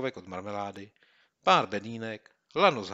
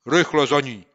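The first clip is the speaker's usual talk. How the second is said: louder, higher order to go; commanding call; 5 semitones higher, 14 dB louder than usual. Acted. Boy ordering his friend after the bottle, commanding. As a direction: give the command urgently. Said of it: "zavelel Kopyto".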